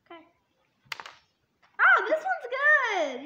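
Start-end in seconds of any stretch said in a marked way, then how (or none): 1.8-3.2 loud, high, slow exclamation; surprised delight. Real, not acted. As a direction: stretch it out amazed. Reacting to tasting a bean.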